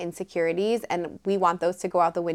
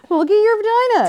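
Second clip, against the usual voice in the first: high, loud hyped cheer; 13 semitones higher, 6 dB louder than usual. Pretend. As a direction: shout it like a cheerleader. Mock pep talk.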